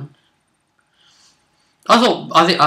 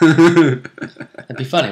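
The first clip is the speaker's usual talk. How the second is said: laughing; 4 semitones higher, 7 dB louder, much slower than usual. Real, not acted. Laughing at headbutt monk idea.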